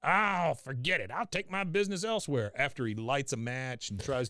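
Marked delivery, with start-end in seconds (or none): 0.0-2.5 Cotton voice. Retold or imitated. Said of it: "yelling like Cotton does".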